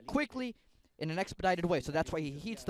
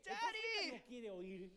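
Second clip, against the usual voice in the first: put-on high child's voice calling for his dad; boy calling out; 12 semitones higher, 11 dB quieter, much slower than usual. Bound son calling to his father, high child's call. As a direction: call out as a small boy who can't see his father.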